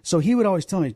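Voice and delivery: usual speaking voice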